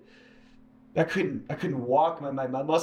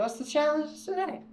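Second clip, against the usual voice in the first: wary question; 12 semitones higher than usual. Retold or imitated. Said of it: "And I go".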